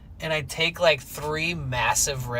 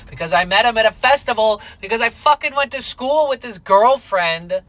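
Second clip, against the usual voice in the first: heated sarcasm; 7 semitones higher, 8 dB louder than usual. Retold or imitated. Sarcastic rant about young dentist.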